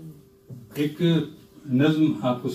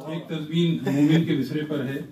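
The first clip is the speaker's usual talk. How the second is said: ordinary delivery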